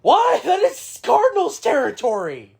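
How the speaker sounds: high, loud cry of protest; indignant outburst. Real, not acted. Mock outrage over rival's territory.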